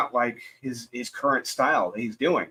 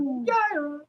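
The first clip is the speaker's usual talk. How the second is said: cheering name; 13 semitones higher, slower than usual. Real, not acted.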